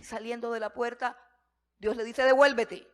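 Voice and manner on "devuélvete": loud sudden call to turn back; commanding shout. Acted. God calling the prophet Isaiah back, a loud commanding shout. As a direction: call him back with full force.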